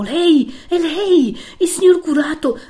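high, loud, bewildered cry; voicing a distraught woman who suddenly recognises someone; astonished exclamation. A narrator's reading. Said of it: "Lo fissò di nuovo ed esclamò".

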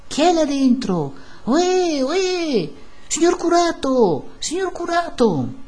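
high, drawn-out hail; calling out. A narrator's reading. Crowd outside hails the priest indoors, calling out. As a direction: call up to someone behind a shut window. Crowd calls up to shut windows.

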